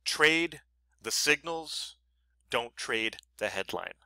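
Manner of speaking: "trade the signals" said very slowly, leaning on "trade"; slow emphatic maxim. Shown as a demonstration. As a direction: drive home the rule word by word. Lesson's closing maxim.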